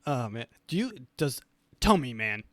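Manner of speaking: ordinary talk, nothing marked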